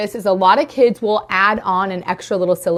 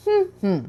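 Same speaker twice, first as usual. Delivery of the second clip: two high hums for "Table"; humming syllables; 10 semitones higher than usual. Shown as a demonstration. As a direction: hum twice, mouth closed. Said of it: "they're just going to hum the word".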